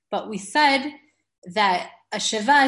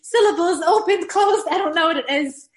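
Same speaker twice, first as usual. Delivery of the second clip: nervous; 8 semitones higher, 5 dB louder than usual. Retold or imitated. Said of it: "I said".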